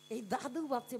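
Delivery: usual speaking voice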